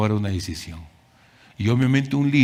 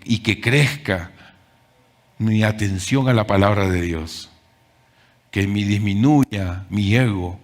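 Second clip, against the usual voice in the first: usual speaking voice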